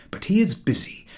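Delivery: ordinary delivery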